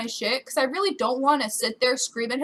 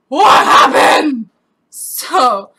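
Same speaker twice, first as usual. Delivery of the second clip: loud, drawn-out wail; hammed-up cry of dismay; distraught yell; 14 dB louder, much slower than usual. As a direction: bellow it in shocked disbelief. Acted reaction to account closure message.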